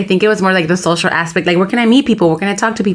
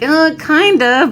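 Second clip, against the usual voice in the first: unsure hedge; 9 semitones higher, slower than usual. Retold or imitated. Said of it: "I'm like".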